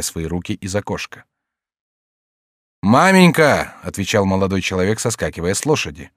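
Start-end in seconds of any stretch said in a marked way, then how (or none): 2.8-3.8 high, loud greeting; calling out. A narrator's reading. Young man arriving on horseback, calling his mother. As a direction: call up joyfully to mother. Son calls back to mother.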